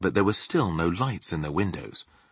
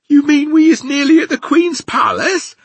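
very high, loud giant amazement; astonished cry; 12 dB louder than usual. A narrator's reading. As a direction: cry out the question in disbelief. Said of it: "cried the B F G".

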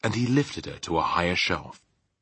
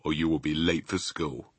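low, softer gruff-father scolding; understated fatherly telling-off; father's real voice; 5 semitones lower, 4 dB quieter than usual. A narrator's reading. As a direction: drop low to rouse a lazy child. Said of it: "hearing my father's real voice".

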